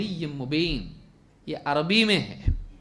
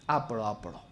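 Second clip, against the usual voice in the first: imitating a shy man's whispered dodge; embarrassed whisper; 8 semitones lower, 5 dB quieter, faster than usual. Shy man whispers to get out of reading when asked to recite. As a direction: whisper it, pushing the turn onto someone else.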